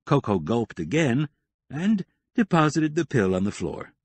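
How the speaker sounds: usual speaking voice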